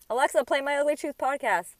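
high, loud call to a smart speaker; pretend voice command. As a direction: call out brightly to Alexa as a playful plug. Mock command to a voice assistant.

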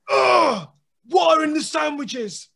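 high, loud anguished cry; desperate wail. Retold or imitated. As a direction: plead as if the world ends. Sam wailing about sandwiches.

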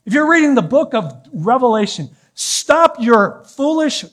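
high, loud fiery preaching. Acted. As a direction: preach it at full force. Preaching builds to full force.

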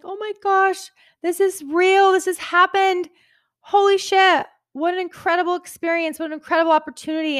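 high, teary, overwhelmed voice; choked up with grateful disbelief; emotional, near tears. Retold or imitated. Opening the book shipment, tearfully overwhelmed. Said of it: "I started getting emotional".

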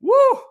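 a sudden high, loud woo; whooping yelp. Real, not acted. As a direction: let out a startled high whoop. Reacts to automakers going this way.

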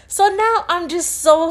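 ordinary delivery

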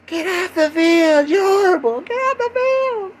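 high, loud, stretched-out jeer; heckling fan. Acted. Fan jeering a team losing by fifty, heckling shout.

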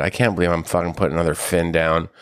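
usual speaking voice

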